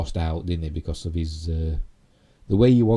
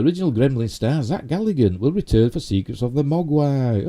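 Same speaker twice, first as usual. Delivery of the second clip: higher, louder headline voice; headline read; 7 semitones higher, 4 dB louder than usual. Real, not acted. Host reads Shatner headline to viewers, headline read. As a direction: announce the news headline with a big lilt.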